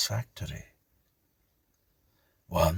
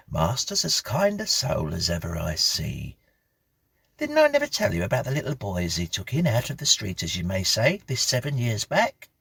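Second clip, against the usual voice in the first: put-on homely old housekeeper; 7 semitones higher, 3 dB louder than usual. Mrs Bunch voiced.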